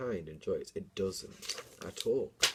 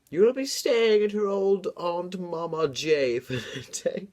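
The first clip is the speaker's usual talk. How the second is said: a put-on mother's voice, higher and louder; mum's voice; 10 semitones higher, 6 dB louder than usual. Reading her story, voicing the mum sending her away. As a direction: voice the mum telling her child.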